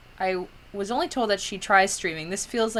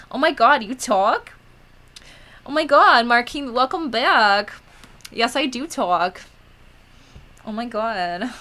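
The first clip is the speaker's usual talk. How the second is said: drawn-out, gushing Valley Girl mock amazement; 3 semitones higher, 4 dB louder than usual. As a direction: play up a Valley Girl, stretching the vowels. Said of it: "That voice. Who is this?".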